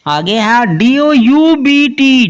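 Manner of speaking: ordinary delivery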